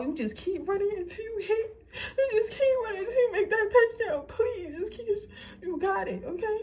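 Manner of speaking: high, tearful cheering-on; crying. Real, not acted. Reader begging viewers to keep running, crying. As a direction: urge them forward with a breaking voice. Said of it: "I don't really wanna cry".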